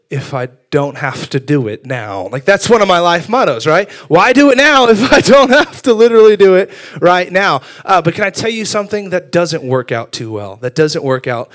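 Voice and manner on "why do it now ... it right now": loud, high-pitched exasperated plea; hammed-up procrastinator's outcry; mock protest. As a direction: protest it loudly, pitch jumping up. Repeats motto in mock protest.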